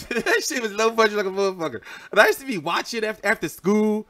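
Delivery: giggly, amused recollection; laughing. Real, not acted. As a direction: laugh through the memory of watching after school. Laughing at old low-budget show.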